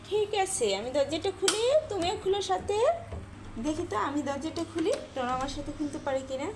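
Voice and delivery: bright playful talk to the pet. Real, not acted. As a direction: invite the pet to play along.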